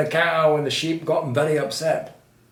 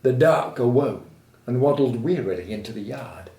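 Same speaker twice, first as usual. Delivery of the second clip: usual speaking voice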